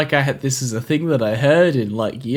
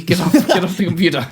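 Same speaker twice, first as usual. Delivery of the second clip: higher, insistent mock scolding; nagging; 5 semitones higher than usual. Symbiote telling Eddie off over gaming, nagging. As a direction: pester them to stop playing. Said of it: "It just nags you all day".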